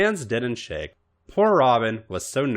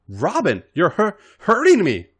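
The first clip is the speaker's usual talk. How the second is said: much higher, louder, breaking cry of pain; 9 semitones higher, 6 dB louder than usual. Acted. Missy to Robin, crying out in pain. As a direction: stammer out a hurt plea.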